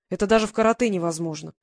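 plain reading voice

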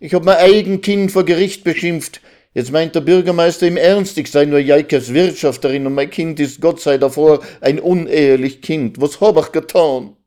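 high, loud, distraught lament; wailing. Acted. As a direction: lament loudly, blaming yourself. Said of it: "jammerte Resel". (verzweifelt jammern)

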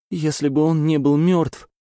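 high, loud sobbing yell; angry crying. Acted. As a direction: cry out in grieving rage. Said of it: "рыдая, прокричала Элси".

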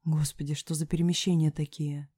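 ordinary delivery, nothing marked

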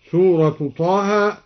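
ordinary talk

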